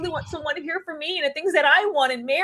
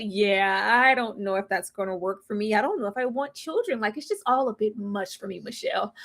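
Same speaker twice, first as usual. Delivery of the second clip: lower, softer mocking mumble; mock Cameron, quiet; 4 semitones lower, 5 dB quieter than usual. Host mocking Cameron's hedging, soft low mumble. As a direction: mumble it like a hesitant man. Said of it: "It's like".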